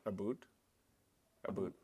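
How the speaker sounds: "boot" with a long oo, for "about". Retold or imitated. Mocking how people think Canadians say about, "a boot" with oo. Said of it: "Everybody thinks a Canadian says".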